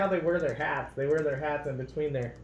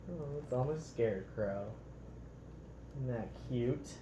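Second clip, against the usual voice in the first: ordinary delivery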